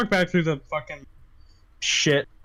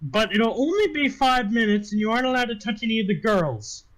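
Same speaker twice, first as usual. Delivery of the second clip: higher-pitched madam laying down rules; brothel madam; 6 semitones higher than usual. Acted. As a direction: lay down the law in a raised, put-on voice. Game master voices madam setting rules.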